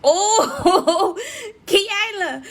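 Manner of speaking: bursting out laughing, high and loud; real laugh at a friend's comment; cracking up at Kiala's name. Real, not acted. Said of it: "I'm laughing because Kiala".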